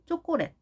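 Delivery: "초콜릿" with tensed jjo-kko-ret sounds; tense first consonant on "초콜릿"; colloquial Korean pronunciation. Shown as a demonstration. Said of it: "또 말로는".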